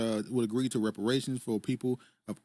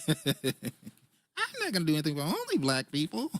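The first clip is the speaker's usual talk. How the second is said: high, drawn-out put-on voice, laughing; higher, slow caricature between laughs; mock Kamala voice; 4 semitones higher, slower than usual. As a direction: impersonate a politician, slow and higher-pitched. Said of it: "Thanks for doing The Voice".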